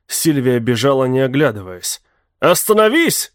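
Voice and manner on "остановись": loud, high shout to stop her. A narrator's reading. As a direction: yell it after her. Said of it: "закричал он". (громкий крик)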